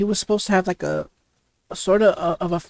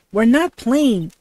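indignant protest; 4 semitones higher, 6 dB louder, slower than usual. Acted. Kid rebuts officer in game.

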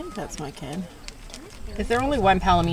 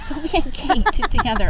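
excited announcement; 7 semitones higher, 7 dB louder than usual. Real, not acted. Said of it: "I just ate, guys".

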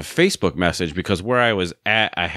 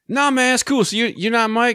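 much higher, goofy put-on caller voice; stupid voice. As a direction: pretend to be a clueless stranger looking for Mike. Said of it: "I put on some stupid voice".

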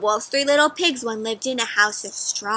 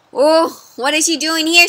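plain reading voice

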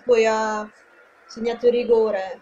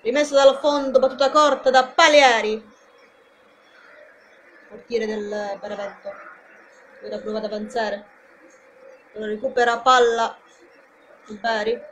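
lively play-by-play call; live football commentary. Acted. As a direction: call the match for the fans.

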